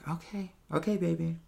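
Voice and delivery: soft, indulgent reassurance; mother soothing her frustrated son; tender okay baby. Retold or imitated. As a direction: humor him gently, like a mother.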